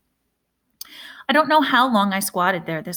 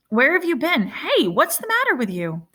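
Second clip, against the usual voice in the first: higher, urgent big brother voice; alarmed brother finding the boy soaked; big brother Caleb, worried, pitched up; older brother Caleb startled; 6 semitones higher than usual. A narrator's reading.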